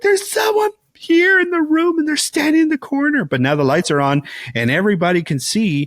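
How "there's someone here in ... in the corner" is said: much higher, panicked voice, rushing at the end; a scared girl pleading with her parents, high and frantic; terrified child. Retold or imitated. Girl telling her parents about the ghost, high terrified voice. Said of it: "and she's like".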